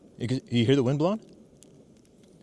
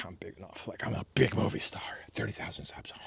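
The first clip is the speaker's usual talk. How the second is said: mock brag whisper; 4 dB quieter than usual. Self-mocking brag after laugh.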